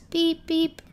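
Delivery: high double beep; imitated car horn. Player stuck among bumper cars, playful high beeping. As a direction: toot it like a little horn.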